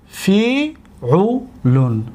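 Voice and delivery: فیعلون very slow, each syllable set apart. Shown as a demonstration. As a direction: sound out فیعلون as fi, u, lun. Teacher sounds out second pattern.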